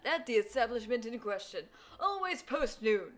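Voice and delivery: put-on loud, high rambling old gentleman; old man voice. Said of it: "that old man is saying".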